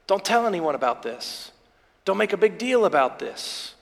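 soft, low, a gentle hush to the crowd; quietly urging secrecy; hushed warning. Acted. Jesus quietly telling the crowd to keep it secret, hushed.

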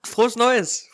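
high, loud cheerful call; excited greeting. Real, not acted. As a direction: call out the New Year wish. New Year greeting called out.

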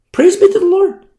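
high, loud praise; praise exclamation. Acted. Exclaims praise after mimicry.

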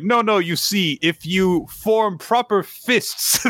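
high, slow, patronising tutor voice; mock street advice. Joking about Fang's street lessons, high and slow mock tutor. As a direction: explain fists like a fussy teacher. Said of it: "he just thought it was like".

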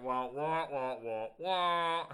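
loud muffled trombone-like wah wah; muffled wah wah talk. Retold or imitated. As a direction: imitate a garbled distorted mic. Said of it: "'Cause it was like".

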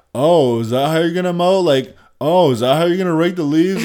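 high, loud nagging mimicry of a critic; mocking nagging voice. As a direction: mimic a pestering know-it-all.

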